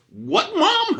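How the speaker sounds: put-on shrill high kid yelling back; a high loud bratty child; kid sassing mom. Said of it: "talking like Stephen A. Smith".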